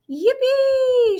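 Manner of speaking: very high, loud whoop of joy; gleeful shout. Acted. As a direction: whoop like a kid on a ride. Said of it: "shouted Grandpa Joe".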